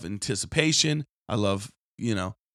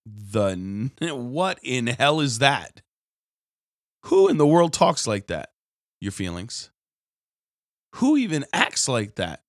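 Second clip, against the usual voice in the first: ordinary delivery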